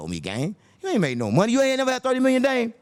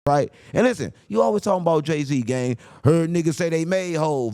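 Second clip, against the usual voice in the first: usual speaking voice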